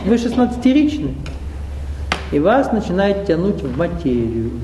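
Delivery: normal talking voice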